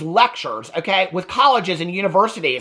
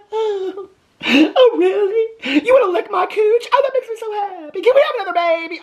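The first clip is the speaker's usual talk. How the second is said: much higher, giggly-weepy mock swoon; mocking Robin; 13 semitones higher than usual. Mocking Robin's reply to Cody, high and giggly-tearful. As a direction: ham up a gushing, lovestruck wife. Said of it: "And she's like".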